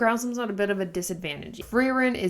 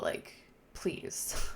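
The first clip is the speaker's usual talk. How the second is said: whispered scoff; 9 dB quieter than usual. Real, not acted. Dismissing the villain she dislikes.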